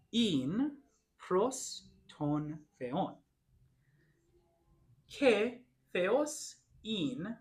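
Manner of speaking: slow, careful reading of the Greek verses, word by word; reading slowly. Shown as a demonstration. Teacher reads Greek to the class slowly for listening practice. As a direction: read each phrase very slowly so they can follow. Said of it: "I'm gonna read it slowly".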